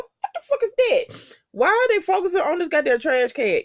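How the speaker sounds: high-pitched incredulous retelling; baffled disbelief. Retold or imitated. Reviewer retells reacting to the trash-can shot, high-pitched mock disbelief. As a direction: question it high, in exaggerated bafflement.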